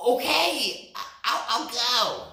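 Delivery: a nervous rabbit working up courage; timid rabbit. A narrator's reading. Rabbit agrees to ride the lion, high and hesitant.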